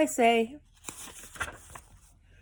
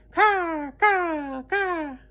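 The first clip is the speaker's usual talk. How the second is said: higher, cawing like a mocking crow; crow caws; 7 semitones higher, much slower than usual. A narrator's reading. Rabbit imagined as a crow cawing, higher. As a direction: caw down at the man. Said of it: "laughed at the man".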